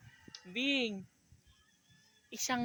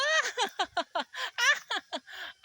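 high burst of giggling while tickled; squealing laugh from being tickled; 13 semitones higher, 6 dB louder than usual. Real, not acted. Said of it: "nakikiliti ako". (tawang nakikiliti)

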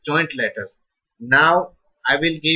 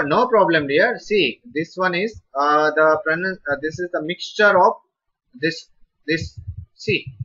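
ordinary talk, nothing marked

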